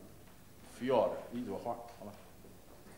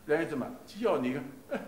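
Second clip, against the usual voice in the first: ordinary delivery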